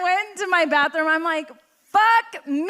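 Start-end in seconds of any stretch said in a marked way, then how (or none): 1.8-2.7 higher, louder mock outburst of dismay; shocked shout. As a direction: blurt it out in horror at yourself. Alone in bathroom, shocked.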